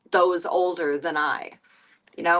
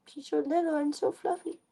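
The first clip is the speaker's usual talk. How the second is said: cooing to cat; 7 semitones higher, 4 dB quieter than usual. Acted.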